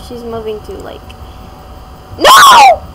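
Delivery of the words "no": high, loud panicked no. Real, not acted. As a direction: scream it in dismay. Game goes wrong.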